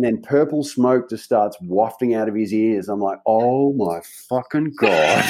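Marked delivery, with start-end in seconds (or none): 3.3-5.2 slow stunned horror. Retold or imitated. Sees boss fuming.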